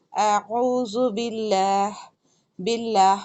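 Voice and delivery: اعوذ باللہ slow with thin lam after zer; light lam. Shown as a demonstration. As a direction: recite slowly, keep the lam light. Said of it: "یہاں پر باریک پڑھ رہی ہوں".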